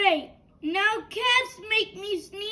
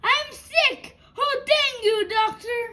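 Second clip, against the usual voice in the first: overjoyed; 4 semitones higher than usual. Acted. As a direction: cheer it out with joy. Gerald overjoyed at diagnosis.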